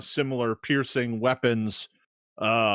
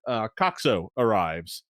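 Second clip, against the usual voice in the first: ordinary talk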